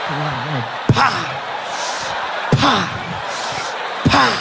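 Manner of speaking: sharp slap noises; spank sound effects. Acted. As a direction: smack the air with pa.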